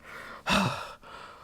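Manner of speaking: acted heavy panting; hard breathing. Dreamer checking his body on waking, breathing hard. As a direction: pant hard as if just woken in fright. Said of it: "and I'm breathing hard".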